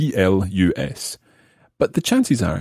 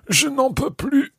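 much higher-pitched mock protest; acted overfull exclamation. Teacher pretends to have eaten a big meal, high-pitched protest. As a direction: cry out that you can't manage another bite, pitched right up. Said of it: "after eating a big meal".

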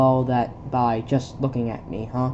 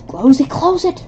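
higher, urgent yelling; panicked shout; 11 semitones higher, 7 dB louder than usual. Acted. Soldier shouting to close the door, panicked shout. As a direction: order it shut in a panic.

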